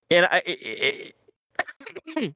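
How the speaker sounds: choking mid-sentence; struggling to talk while choking. Real, not acted. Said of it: "as I'm choking".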